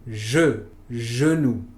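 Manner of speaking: "je, genou." with the French schwa, rounded and relaxed; French schwa words. Shown as a demonstration. Teacher modeling French schwa for Spanish learners, careful French pronunciation. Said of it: "Por ejemplo".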